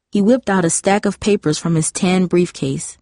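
usual speaking voice

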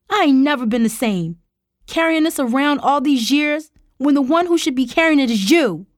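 high, loud, wounded outburst; pained fury. A narrator's reading. Tara blames her uncle for her suffering, pained fury. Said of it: "fury and pain spilling into her voice".

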